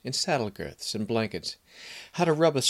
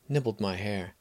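ordinary delivery, nothing marked

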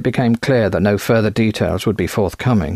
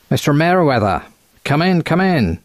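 higher-pitched, quacking American voice; quacking American accent; 6 semitones higher than usual. A narrator's reading. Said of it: "he said in a quacking American accent".

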